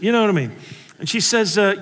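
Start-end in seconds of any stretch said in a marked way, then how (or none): none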